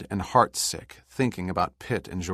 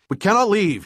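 much higher, louder sharp protest; 13 semitones higher, 9 dB louder than usual. A narrator's reading. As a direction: cut in urgently. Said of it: "he said sharply".

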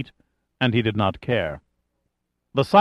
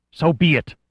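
cold, higher-pitched retort; cold hiss; 5 semitones higher than usual. A narrator's reading. Leader answering his observer's warning, coldly hissed. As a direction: dismiss it icily, in a short burst. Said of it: "Ismail hissed, his voice as cold as the snow".

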